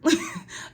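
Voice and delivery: unvoiced airy laugh; breathy laugh. Real, not acted.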